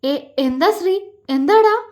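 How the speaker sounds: alarmed husband, voice jumping up; frightened. A narrator's reading. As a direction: panic breaking through the question. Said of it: "അവൻ പേടിയോടെ ചോദിച്ചു".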